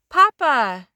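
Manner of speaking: loud happy call. A narrator's reading. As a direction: call grandpa across the yard. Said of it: "they called".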